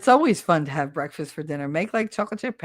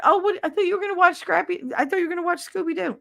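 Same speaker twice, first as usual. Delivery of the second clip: put-on much higher and fast surprised girlfriend; quick high incredulous partner; girlfriend's voice; 12 semitones higher, much faster than usual. Girlfriend surprised he skips Scooby-Doo, high and fast. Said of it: "My girl's like".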